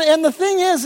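ordinary talk, nothing marked

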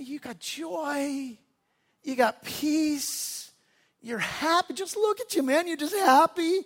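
soft and slow, almost in awe; awed tenderness. Retold or imitated. Roger tells the sweaty pastor he has joy, hushed and slow.

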